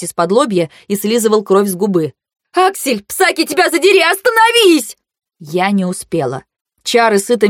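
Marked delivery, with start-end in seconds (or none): 2.5-5.0 high, climbing cry; desperate yell. A narrator's reading. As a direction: yell it upward to halt him. Desperate shout to stop brother. (отчаянный крик, высоко)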